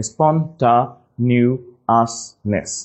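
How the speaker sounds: very slow "স্পন্টানিয়াসনেস" with a spelling-style "ta"; broken into syllables. Shown as a demonstration. Teacher helping class remember, "স্পন্টানিয়াসনেস" slowly split. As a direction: split "স্পন্টানিয়াসনেস" as spon, ta, nias, ness. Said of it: "একটু ভেঙে নিলে".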